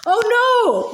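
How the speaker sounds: mock wail of dismay. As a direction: cry out as the dog escapes. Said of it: "wailed all three girls".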